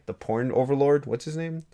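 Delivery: ordinary talk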